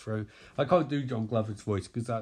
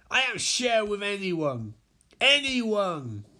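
high, indignant refusal; possessive greedy villain; 8 semitones higher than usual. Acted. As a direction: draw out ANYONE possessively, pitched up. Greedy Larfleeze refuses to share.

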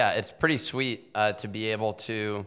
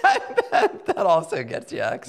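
laughing; 5 semitones higher, 3 dB louder than usual. Real, not acted. Still laughing at joke.

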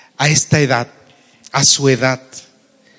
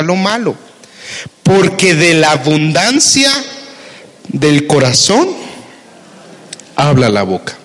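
scripture reading; 3 semitones higher than usual. Acted. Preacher reads Luke to the congregation, declaiming. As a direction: proclaim the verse to the church.

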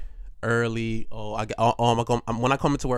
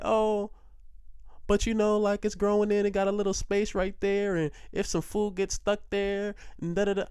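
higher, lightly mocking dentist voice; 8 semitones higher than usual. Dentist pushing wisdom teeth removal, mock high voice. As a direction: ham up the dentist's pitch, higher.

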